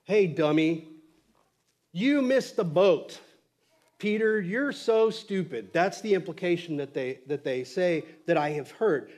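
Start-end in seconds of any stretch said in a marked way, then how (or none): 0.0-5.7 loud, slow put-down in a mock-scolding voice; scolding Jesus. Pastor mimicking how others preach Jesus rebuking Peter, mock scolding. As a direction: imitate a harsh reprimand, punching each phrase. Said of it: "half the time I hear it like this".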